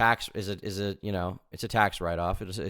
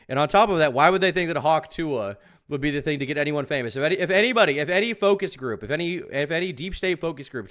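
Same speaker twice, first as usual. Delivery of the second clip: loud, raised pitch, in disbelief; incredulous ranting; 7 semitones higher, 7 dB louder than usual. Real, not acted. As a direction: argue loudly against a silly theory. Incredulous rant against the theory.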